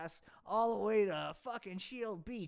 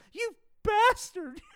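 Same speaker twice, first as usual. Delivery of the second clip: much higher, louder, slow shriek; Barbie shrieking; 12 semitones higher, 11 dB louder, slower than usual. Acted. Barbie shrieks at Ken, hammed-up shriek. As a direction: wail the insult at him. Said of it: "said Barbie".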